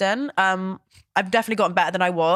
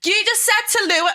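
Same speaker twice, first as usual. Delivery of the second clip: much higher-pitched comic impression; Hannah; 13 semitones higher than usual. Shown as a demonstration. Showing off an impression for the co-host, high hammed-up voice. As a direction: put on an exaggerated, high regional voice. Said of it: "I can do Hannah from".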